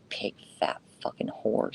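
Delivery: whispered venomous insults; a taunting whisper; hissed whisper. Real, not acted. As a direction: breathe the slurs out at them.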